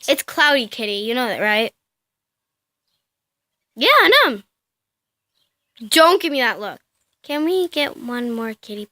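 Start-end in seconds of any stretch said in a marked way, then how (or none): 3.8-4.4 high squeaky cat reply; pet voice. Pretend. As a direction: speak for the cat. Voicing the cat's reply.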